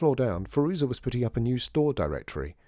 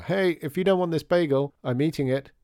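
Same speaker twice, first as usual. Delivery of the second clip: raised, higher calling voice; calling after; 6 semitones higher, 4 dB louder than usual. A narrator's reading. Father calling after Lydia about her abandoned bagel, calling after. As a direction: holler it to someone leaving the room. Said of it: "called her father after her".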